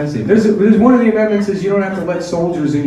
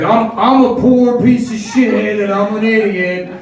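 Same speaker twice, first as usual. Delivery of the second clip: mock [name]; 5 semitones higher than usual. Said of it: "he's like".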